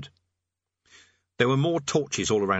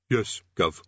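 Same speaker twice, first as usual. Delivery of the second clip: distant underling; 2 semitones lower than usual. A narrator's reading. Distant underling answers the king.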